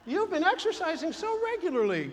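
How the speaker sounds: high, admiring gush; gushing compliment. Retold or imitated. Acquaintance compliments someone's exercise, high gushing praise. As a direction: pitch it high, full of admiration.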